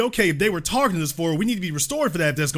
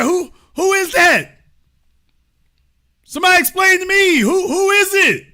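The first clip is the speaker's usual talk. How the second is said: incredulous shouting; 8 semitones higher, 4 dB louder than usual. Real, not acted. Host reacting to clip in disbelief.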